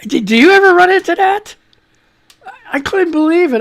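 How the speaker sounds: high, loud amazement; can't believe a spider got in; amazed. Real, not acted. To the audience about the bathtub spider, high and loud with amazement.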